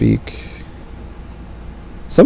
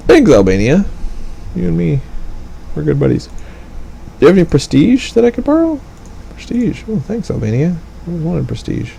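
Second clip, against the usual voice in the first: much higher, fast, chirpy little ally; high mock Albania voice; 7 semitones higher than usual. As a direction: squeak it like an eager small friend.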